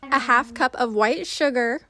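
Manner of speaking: loud upbeat recipe narration; voiceover. Acted. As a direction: read it like a cooking show host. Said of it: "switching over to voiceover".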